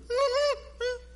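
put-on high little child's whimper; a quick, high, hurt whimper; imitated whimper. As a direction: mimic a small boy's whimper after a sting. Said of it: "like that".

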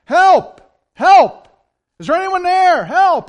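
full desperate shouts from far below; yelling for help. Acted. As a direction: scream for help off a cliff. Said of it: "he starts yelling for help".

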